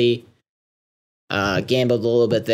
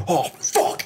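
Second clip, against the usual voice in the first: 1 loser's curse; 6 semitones higher than usual. Retold or imitated. Dude losing five hands.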